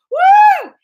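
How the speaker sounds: high loud whoop; excited whoop. Retold or imitated. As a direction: cheer it out. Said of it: "I was all".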